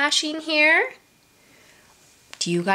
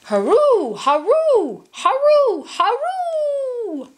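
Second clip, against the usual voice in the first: high rising rooster crow; 12 semitones higher than usual. Acted. Rooster crowing in picture book.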